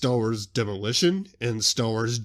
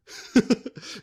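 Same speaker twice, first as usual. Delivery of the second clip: laugh; 13 semitones higher, 3 dB louder than usual. Real, not acted. After his own synopsis.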